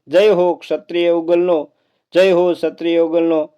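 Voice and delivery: story narration. A narrator's reading. Storyteller reading a Rajput legend to listeners, solemn narration. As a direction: tell the old tale with gravity. Reads folk tale aloud.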